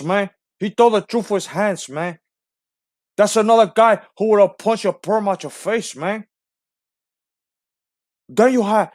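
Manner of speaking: put-on trash-talk like a heavily accented movie drug lord; Tony Montana. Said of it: "it's Tony Montana".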